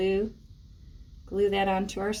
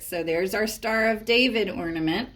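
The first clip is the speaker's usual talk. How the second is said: ordinary delivery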